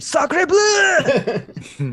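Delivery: put-on high, loud cry in a French accent; French war cry in a French accent. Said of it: "The clear French, um, war command".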